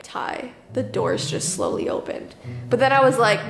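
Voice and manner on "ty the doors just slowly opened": hushed whisper to sleeping husband. Retold or imitated. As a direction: breathe it out, no voice. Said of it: "about to wake him up to be like".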